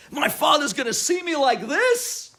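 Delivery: loud, high, stretched-out dismay; panicked. Retold or imitated. Son learning his father is coming, loud panic. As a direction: cry out in alarmed disbelief.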